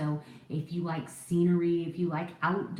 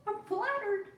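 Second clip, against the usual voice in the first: very high, tiny giggly voice; coy flattered squeal. Pretend. Coyly answers a compliment, giggling.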